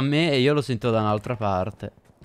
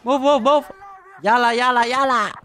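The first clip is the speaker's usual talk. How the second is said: high shouted orders; loud urgent yelling; 12 semitones higher, 6 dB louder than usual. Real, not acted. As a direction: bark the orders at the team. Gamer yelling commands.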